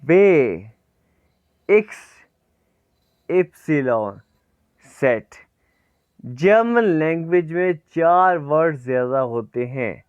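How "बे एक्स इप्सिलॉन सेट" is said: teacher calling German letters; alphabet recitation. Shown as a demonstration. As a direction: keep reciting the letters clearly. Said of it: "मेरे साथ साथ इसको pronounce करना".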